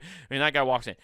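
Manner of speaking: ordinary delivery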